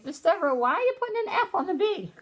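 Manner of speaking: high, fast imitation of children calling out; mock kids yelling. Teacher imitating kids calling out her mistake. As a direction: pretend to be kids correcting the teacher. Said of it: "You guys were probably yelling at your iPad".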